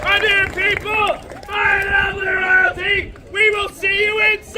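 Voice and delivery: projected showman's farewell; calling out. Real, not acted. Performer bidding crowd and royalty inside, calling out. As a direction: call it out across the crowd.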